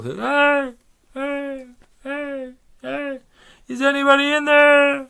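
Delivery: very high, loud echoing holler; sustained high-pitched call. Acted. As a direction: call into a mountain and fade like an echo. Calling into a mountain for echoes.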